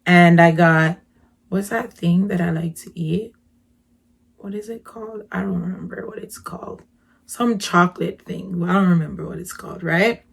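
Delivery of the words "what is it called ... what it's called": breathy whisper under her breath; muttering while searching memory; whispered to herself. Real, not acted. Trying to recall snack.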